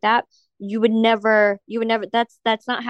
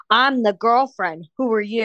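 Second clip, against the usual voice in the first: slow, pointed mock introduction; retold confrontation. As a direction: stretch it out to stake your claim. Retold confrontation with two girls.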